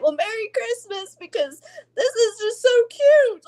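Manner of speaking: high, loud and choked up with tears; teary. Real, not acted. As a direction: push through happy tears.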